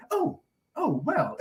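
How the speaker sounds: high, soft flustered English politeness; mock the awkward polite host; polite Englishman. Host hiding the poker from guests, mild polite fluster. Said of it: "and it's just like".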